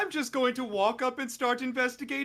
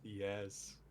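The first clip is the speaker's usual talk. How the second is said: much lower, softer eerie twins; 14 semitones lower, 5 dB quieter, much slower than usual. Acted. Twins answering the NPC together, low and eerie. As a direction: speak as one, low and eerie.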